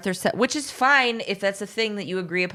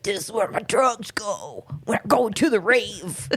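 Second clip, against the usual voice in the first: put-on nineties raver kid; 3 semitones higher than usual. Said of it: "my nineties fucking raver kid".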